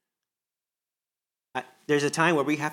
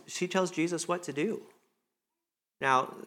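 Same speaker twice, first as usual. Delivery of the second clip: usual speaking voice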